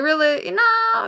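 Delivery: high-pitched mock-incredulous comeback; acted disbelief. Woman replaying her comeback to a lover, mock-incredulous. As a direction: retort in exaggerated disbelief, pitch leaping up.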